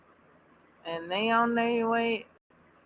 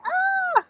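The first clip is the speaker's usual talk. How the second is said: very high, loud squeal; piercingly high cry of delight; 5 dB louder than usual. Retold or imitated. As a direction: let out a high fangirl squeal. Re-enacting her squeal at cute scene.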